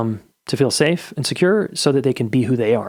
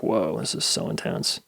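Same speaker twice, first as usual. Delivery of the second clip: slow, low, softer mock marvelling; low-key awe; 4 semitones lower, 7 dB quieter than usual. Dad watching his son's tantrum, slow quiet awe. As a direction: let "whoa" stretch out, low and hushed.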